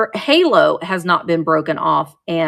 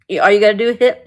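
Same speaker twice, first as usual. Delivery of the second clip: ordinary talk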